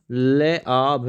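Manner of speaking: slow, letter by letter; slow spelling out. Shown as a demonstration. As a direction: dictate the letters slowly. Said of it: "यशो लाभ". (धीरे-धीरे वर्तनी बोलते हुए)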